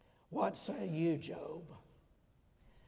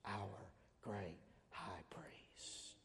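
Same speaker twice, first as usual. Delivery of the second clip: hushed whisper; 11 semitones lower, 19 dB quieter, much slower than usual. Real, not acted. Preacher whispering to the congregation about Christ. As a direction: drop to a breathy whisper.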